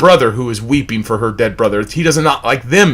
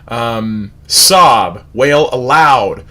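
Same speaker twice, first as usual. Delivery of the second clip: normal talking voice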